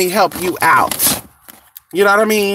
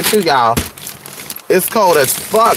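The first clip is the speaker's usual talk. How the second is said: normal talking voice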